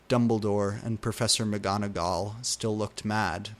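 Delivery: usual speaking voice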